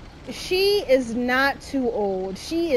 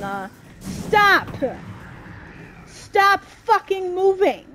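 loud, raised, rising anger; furious yelling; 6 semitones higher, 4 dB louder than usual. Real, not acted. Player yelling at the enemy to stop moving, furious. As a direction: shout it down, losing patience.

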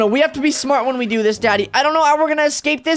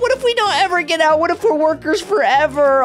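ordinary talk

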